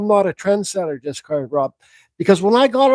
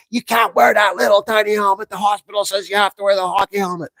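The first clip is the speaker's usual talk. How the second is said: Irish stepdad. Retold or imitated. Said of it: "he's Irish".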